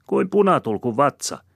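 high, loud jeer; mocking taunt. A narrator's reading. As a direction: needle him shrewishly. Woman continues her taunt.

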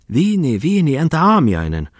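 high, loud, swooping cry; exclaiming hostess. A narrator's reading. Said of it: "huudahti maissirouva".

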